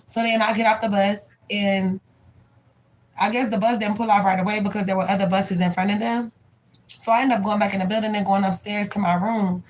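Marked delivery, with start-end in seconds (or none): none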